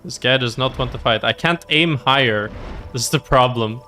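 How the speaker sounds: ordinary talk, nothing marked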